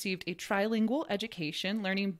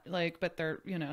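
usual speaking voice

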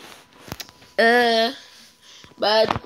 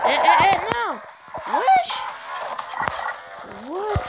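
very high startled yelps; shocked squeal; 8 semitones higher, slower than usual. Real, not acted. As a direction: squeal in sudden disbelief. Sudden shock in game.

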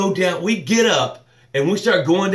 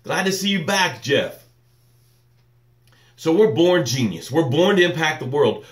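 ordinary talk

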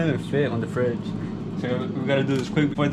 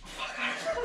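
strained squeak; 8 dB quieter than usual. Real, not acted. Cold shock in ice bath.

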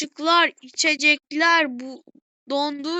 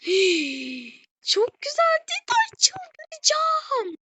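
gasp, then squeal the words very high; so thrilled her voice jumps way up; delighted high squeal; 11 semitones higher, much slower than usual. Real, not acted. To viewers over a tiny detail, a high delighted squeal.

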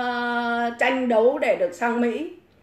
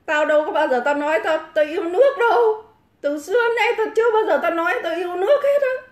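heated laughing protest; 6 semitones higher, 3 dB louder, faster than usual. Real, not acted.

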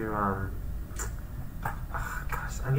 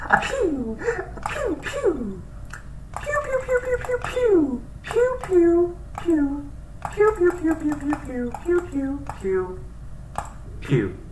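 high squeaky pew pew blasts; laser sound effects. Pretend. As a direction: shoot lasers with your mouth. Shooting asteroids with sound effects.

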